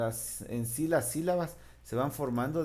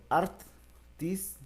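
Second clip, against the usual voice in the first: syllable by syllable; 8 semitones higher than usual. Shown as a demonstration. Teacher to class, breaking a word into syllables. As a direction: split the word into its syllables, art and tis, each as a separate beat. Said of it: "ártico".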